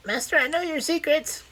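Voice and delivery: very high squeaky mimicry of the butler. A narrator's reading. Craven mimicking the butler to Loveday, squeaky and high. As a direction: squeak it like a sly servant. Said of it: "say in a squeaky voice".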